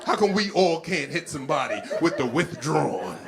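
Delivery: ordinary talk, nothing marked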